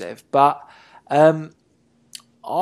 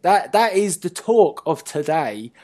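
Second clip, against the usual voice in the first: ordinary talk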